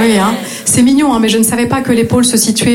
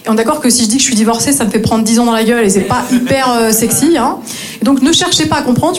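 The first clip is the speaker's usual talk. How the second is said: ordinary delivery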